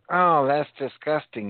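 high, slow, mock-prissy gasp; mock disgust. Friend mimicking the lady's complaint, high mock disgust. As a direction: ham up her disgust. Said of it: "if they go".

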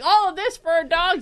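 a hammed-up shocked colleague; incredulous. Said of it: "He's gonna be like".

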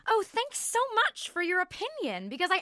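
high-pitched, sarcastic mock gratitude; heavy sarcasm. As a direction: gush with false thanks, then rattle off the rest sarcastically. Retort after being called sarcastic.

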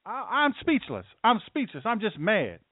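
high-pitched mock protester whine; mad protester. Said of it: "What are you mad at?".

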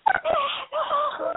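very high, drawn-out mock scream; cursing at the driver who cut in, screaming; road-rage shout. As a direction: scream it at the car that cut you off. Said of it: "I'm like".

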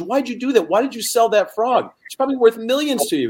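a squeaky, disbelieving barman; high bartender voice. Acted. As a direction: play the bartender high and incredulous.

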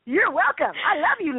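tender, delighted answer; God's loving reply. Acted. God answering laughing ladies, affectionate. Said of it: "He's like".

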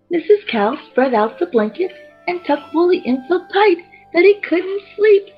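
usual speaking voice

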